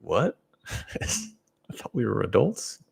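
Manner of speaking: amused incredulous reply; laughing disbelief. Retold or imitated. Answering critics with amused, laughing disbelief. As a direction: laugh through mock disbelief. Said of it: "I'm like".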